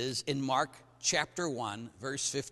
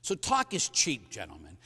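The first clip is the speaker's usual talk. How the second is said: usual speaking voice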